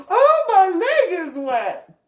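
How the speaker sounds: high, loud, slow mock shriek of disgust; shocked outburst. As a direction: squeal it like she just noticed the wet leg. Relative discovers her wet leg.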